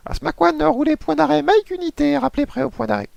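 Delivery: very high squeaky controller voice; control tower voice. Acted. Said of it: "la voix du contrôleur".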